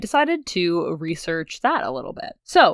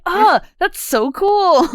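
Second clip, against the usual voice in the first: high, loud, slow gush; gushing with delight, higher and louder; mock gush; 13 semitones higher, 7 dB louder, slower than usual. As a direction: gush like a thrilled fan. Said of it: "And I was like".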